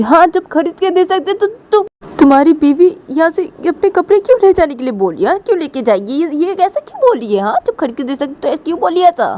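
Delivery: high, loud taunting mother; mother taunting Piyu, high and loud; tearful taunt. Pretend. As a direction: jab at him with scorn. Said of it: "Ruhi की mummy रोते तो बोलती कि".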